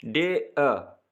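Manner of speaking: डे अ slowly split as day, uh; slow down and separate डे from अ; two separate sounds. Shown as a demonstration. Teacher showing the diphthong in dared, two separate sounds. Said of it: "तो दो आवाज़ें आ रही हैं".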